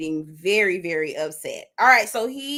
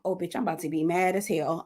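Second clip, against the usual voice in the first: ordinary talk, nothing marked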